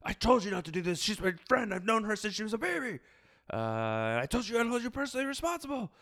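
high, fast, furious scolding; furious rant. Retold or imitated. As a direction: yell at him like a betrayed friend. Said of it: "He's so mad".

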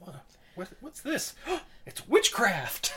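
high, slow, hammed-up gasp of discovery; mock gasp. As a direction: gasp out a wide-eyed discovery. Mock gasp at found note.

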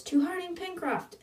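high toast cry, kept soft; mock yell. A narrator's reading. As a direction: imitate a yelled toast without the volume. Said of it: "Elena yells".

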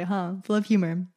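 usual speaking voice

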